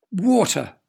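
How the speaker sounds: shouted. A narrator's reading. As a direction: call it out as the idea hits you. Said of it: "shouted the weatherman".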